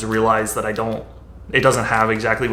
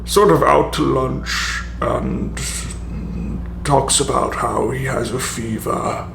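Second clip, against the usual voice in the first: slow, doddering comedic judge voice; Patrick Stewart; slower than usual. Shown as a demonstration. Writer demos the comedic judge route, Patrick Stewart-esque. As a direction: play an Ebenezer Scrooge type, drawn out. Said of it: "sort of like Patrick Stewart-esque judge".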